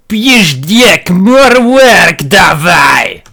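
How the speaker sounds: high, loud Russian peasant shout. Acted. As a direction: bellow it like a Russian Warcraft peasant. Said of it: "I'll do a Russian translation of Warcraft three".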